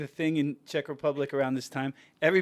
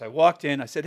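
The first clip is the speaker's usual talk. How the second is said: ordinary delivery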